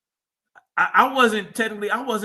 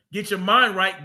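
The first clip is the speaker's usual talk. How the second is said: ordinary talk, nothing marked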